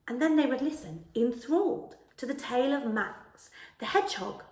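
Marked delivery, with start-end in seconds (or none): none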